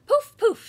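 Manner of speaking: loud high poof poof; magic poofs. Acted. Godma waves her spell over Ellie, loud high poofs. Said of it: "she waved".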